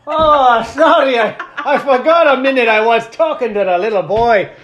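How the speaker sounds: very high, loud comic leprechaun. Retold or imitated. Apologizing to the little boy, loud leprechaun voice. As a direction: belt it out as a flustered leprechaun. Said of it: "keep going to the leprechaun voice".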